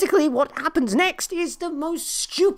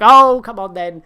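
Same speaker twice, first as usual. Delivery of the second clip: mock crowd taunt; 2 semitones lower, 9 dB louder than usual.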